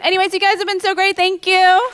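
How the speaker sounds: high, loud and fast thank-you; excited sign-off. Real, not acted. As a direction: rush out a thrilled goodbye to the crowd. Wrapping up her set.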